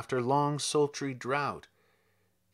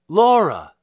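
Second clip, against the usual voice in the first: loud call across the garden; cried out; 2 semitones higher, 9 dB louder than usual. A narrator's reading. Lizzie calls to Laura up the garden, loud cry. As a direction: call her sister's name out loud. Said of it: "She cried".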